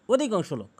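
ordinary delivery

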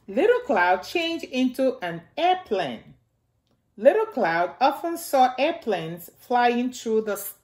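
gentle storybook reading for little ones; reading to children. Acted. Miss Nana reading Little Cloud aloud to the children, storybook voice. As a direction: read it out like story time for kids. Reads picture book to children.

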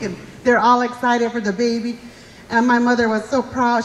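ordinary talk, nothing marked

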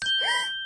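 sharp, high excited gasp. Acted. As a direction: gasp with sudden delight. Pinkie spots returning friends.

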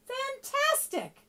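very high, drawn-out cheer; high-pitched acted exclamation; shouted. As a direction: shout praise, stretching the word. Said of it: "but Elizabeth shouted".

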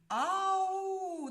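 put-on high, loud long call of a lost girl; shouted call for help. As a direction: yell it out as if lost in the woods. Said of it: "І гукає про допомогу і кричить".

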